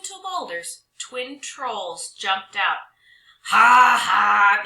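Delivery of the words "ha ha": loud booming troll ha ha; troll bellow. Acted. Twin trolls pounce on the dog, bellowing loud. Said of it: "they bellowed together".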